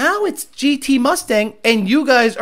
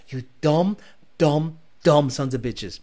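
low scornful mutter; 7 semitones lower, 5 dB quieter, slower than usual. Real, not acted. Scorning rappers on video.

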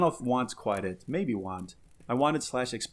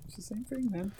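much higher, soft squeak; high squeaky mock; 9 semitones higher, 8 dB quieter, faster than usual. Teasing the uncle with a squeaky high echo. Echoing the wife's line.

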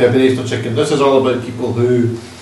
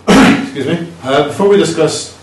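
clearing throat; 5 semitones higher, 4 dB louder than usual. Real, not acted. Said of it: "I'm coughing because I'm medicated".

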